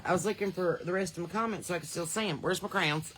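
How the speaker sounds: normal talking voice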